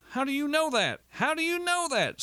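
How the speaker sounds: very high, strained, insistent demand; agitated challenge. A narrator's reading. Walter argues, repeating his challenge.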